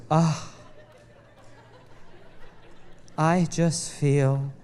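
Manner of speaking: slow, low, dreamy imitation; mock blissed-out wife. Husband mocking wife leaving the crystal shop, slow and dreamy. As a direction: drag it out like a serene spiritual type. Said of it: "she just goes".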